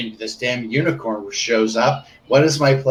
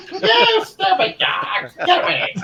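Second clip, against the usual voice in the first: stupid dog voice; slower than usual. Acted. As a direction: spit it at the dog, slow and high. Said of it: "different versions of stupid dog voices".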